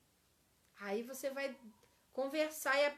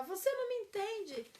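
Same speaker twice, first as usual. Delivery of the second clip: much higher reproachful line; accusing complaint; 7 semitones higher than usual. Shown as a demonstration. Teacher contrasts accusation with confession, high reproach. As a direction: blame them with it. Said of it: "Ao invés de falar".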